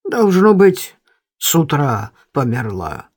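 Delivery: low, puffing heavy voice. A narrator's reading. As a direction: breathe out hard between words. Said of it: "отдуваясь, проговорил он".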